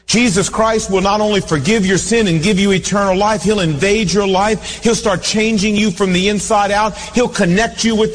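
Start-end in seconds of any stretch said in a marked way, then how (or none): none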